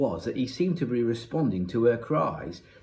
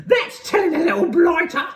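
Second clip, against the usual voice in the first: gleeful high, loud shriek; Missus Pratchett; 8 dB louder than usual. A narrator's reading. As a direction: screech approval from the sidelines. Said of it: "shrieked Missus Pratchett".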